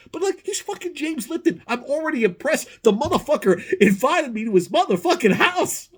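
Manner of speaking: high-pitched, excited rant; incredulous excitement. Retold or imitated. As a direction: exclaim it in high-voiced amazement. Marvels at being invited by Lipton.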